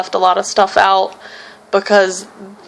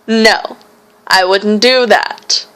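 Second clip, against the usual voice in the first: ordinary talk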